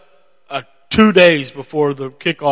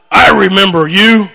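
loud, raised imitation of a huge man; booming big man; 4 semitones higher, 7 dB louder than usual. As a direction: boom it out as the big man recognizing someone. Imitates big man's booming greeting.

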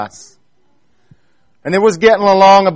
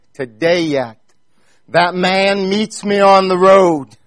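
normal talking voice

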